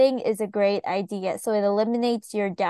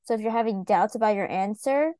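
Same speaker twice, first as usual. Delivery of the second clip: ordinary delivery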